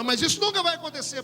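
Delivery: high imitated protest; insistent refusal. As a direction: mimic the girl objecting, pitch lifted. Acting girl's insistent refusal.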